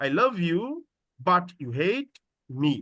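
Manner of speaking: but you hate spoken slowly together, me released separately; slow down and break before me; me set apart. Shown as a demonstration. Teacher demonstrating the clause, me separated. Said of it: "me-টা আলাদা হয়ে যাবে".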